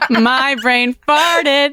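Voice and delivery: slow, laughing through the words; giggling over her own mix-up; laughing at herself. Real, not acted. Podcaster laughing after mixing up actresses, slow giggly words.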